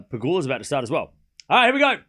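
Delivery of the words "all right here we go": loud high call of the first serve; excited call. Real, not acted. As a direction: announce the serve like it's a big moment. Match start call.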